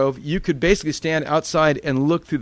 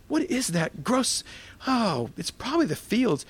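disgusted; 8 semitones higher, 5 dB quieter than usual. Retold or imitated. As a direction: sniff around the house, grossed out. Searching for the smell.

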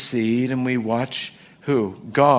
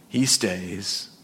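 ordinary talk, nothing marked

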